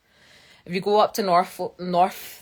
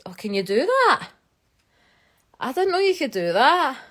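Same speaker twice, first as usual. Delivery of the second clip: surprised; 6 semitones higher, 5 dB louder than usual. Real, not acted. Discovering a stream feature.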